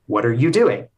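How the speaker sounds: doing stressed with a falling finish; "doing" carries the stress and falls at the end. Shown as a demonstration. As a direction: land the weight on "doing". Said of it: "put emphasis on a different word each time".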